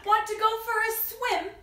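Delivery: high, loud duck's invitation; storybook duck. Acted. Duck invites the spider to swim, high duck voice. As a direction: pitch it up as a duck asking to play. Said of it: "along came a duck".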